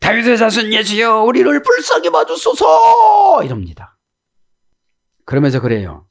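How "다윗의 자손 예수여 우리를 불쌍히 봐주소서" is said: high, slow drawn-out plea; blind men crying out. Retold or imitated. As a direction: wail it out to Jesus for mercy. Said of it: "두 맹인이 막 소리를 지릅니다". (소리 지르며 애원하는 맹인)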